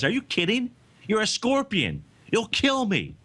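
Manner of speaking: high-pitched mock disbelief, each line falling away at the end; incredulous frog. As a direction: play an alarmed frog, pitched well up. Frog's alarmed refusal in retold fable.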